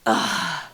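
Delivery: put-on Baby Bear's exasperated "ugh"; drawn-out kid's groan of exasperation. A narrator's reading. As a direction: groan like an exasperated eight-year-old. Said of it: "exasperation that only an eight-year-old baby".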